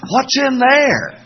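put-on high, slow kid's voice asking; small child calling down from above; child's voice. Kids in the ceiling, high slow kid voice.

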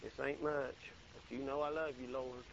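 soft, humble widow praying; a hint of a widow's tender plea; poor widow quietly offering her love to God; quiet humble prayer. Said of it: "She said".

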